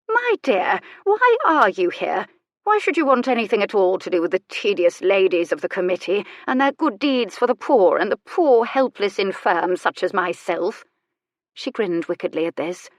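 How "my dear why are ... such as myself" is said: mocking old lady. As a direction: interrogate with arch mock self-pity. Elderly lady questions visitor mockingly.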